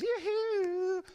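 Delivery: high loud call; cheerful shouted yoo-hoo; carefree yoo-hoo. Acted. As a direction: whoop it out with no cares. Carefree coyote calling out.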